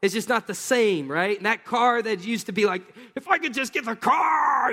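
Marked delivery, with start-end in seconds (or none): 3.1-4.7 high, loud, half-joking cry of wanting; hammed-up yearning for a new car; mock eager longing. Preacher imitating a car-crazed buyer with a loud, high, mock-eager cry.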